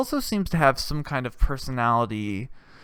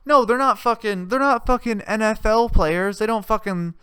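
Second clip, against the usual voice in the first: usual speaking voice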